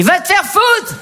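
very high-pitched mock scream; acted yell. Spouses each in their own room, high acted yell. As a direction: yell it through the wall at a spouse. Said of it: "on crie".

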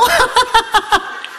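sudden high laugh into the phone; high burst of laughter. Real, not acted.

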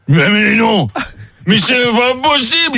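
put-on high, incredulous drunk; drunken amazement. Drunk learning of a shared birth date, amazed and tipsy. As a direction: stammer out tipsy disbelief. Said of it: "ils commencent vraiment à être bourrés".

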